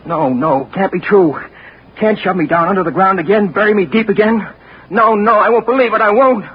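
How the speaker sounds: desperate denial growing frantic; rising panic. Acted. Panic as nurse leaves.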